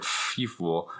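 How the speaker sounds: low, soft mock put-down; quiet, low self-quoted scoff; mock scorn. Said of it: "And I was like".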